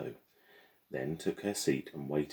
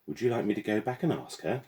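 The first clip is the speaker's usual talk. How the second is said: high, mock-innocent girl's voice; smug classmate, all fake innocence; smirking; 7 semitones higher than usual. A narrator's reading. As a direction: tease her with false sweetness. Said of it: "Gemma smirked".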